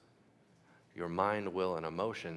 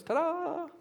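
mock magic reveal; 4 dB louder than usual. God instantly granting faith.

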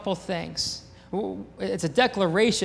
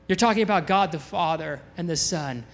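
usual speaking voice

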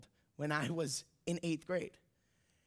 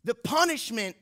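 impassioned preaching; 5 semitones higher, 4 dB louder than usual. Real, not acted. Preacher on undeserved grace, impassioned rising delivery. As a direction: build louder and higher toward the climax.

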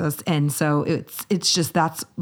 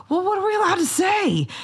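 put-on exasperated kid.

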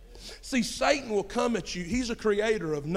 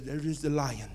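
put-on lower, softer accented TV narrator; accented wildlife documentary voiceover; documentary narrator's accent; 5 semitones lower, 5 dB quieter than usual. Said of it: "this guy with an accent comes on".

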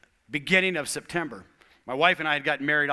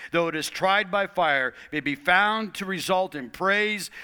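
raised, emphatic Bible reading; scripture reading; 5 semitones higher, 4 dB louder than usual. Acted. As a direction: read it out with weight to the room. Reading scripture aloud to congregation.

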